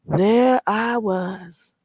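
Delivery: drawn out very slowly, There I was as a dramatic pause. Retold or imitated. Telling chat about the beach wedding, slow dramatic pause. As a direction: stretch There I was out for comic effect.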